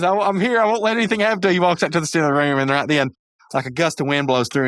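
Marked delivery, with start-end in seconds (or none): 0.0-1.5 higher, mock-brave promise; mock heroic Mordecai. Mordecai getting up punch drunk to save his friends, hammed-up. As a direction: ham up a dazed hero's vow.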